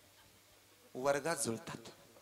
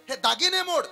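urging; 10 semitones higher, 5 dB louder than usual. Acted.

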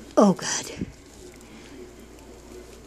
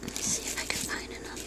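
whispering; 12 dB quieter, faster than usual. Real, not acted. Searching quietly.

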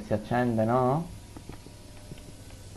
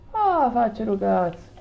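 frustrated yelp; 12 semitones higher than usual. Real, not acted.

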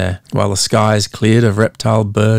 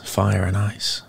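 hushed echo; 8 dB quieter, slower than usual. A narrator's reading. Narrator echoing the monster's eyes, fire and ice hushed. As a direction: fire and ice dropped to a near hush. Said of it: "echo of previous phrase".

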